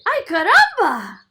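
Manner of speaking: high, loud startled cry; shouted. Acted. Paco startled as the plant shoots up, shouting. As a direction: yelp it out in shock. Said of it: "Paco shouted".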